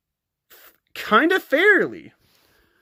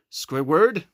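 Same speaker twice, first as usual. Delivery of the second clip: Patrick voice; 10 semitones lower than usual. Pretend. Kid voicing Patrick toy.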